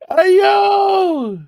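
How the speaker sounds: high, loud call-out; excited shout. Real, not acted. Cracking up at the man on the TV, shouting. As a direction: yell it out in delight. Bursting out at the stream.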